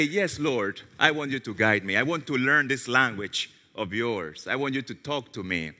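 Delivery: hushed personal prayer to the Lord; soft prayer. Acted. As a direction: pray it quietly and low. Models a quiet prayer.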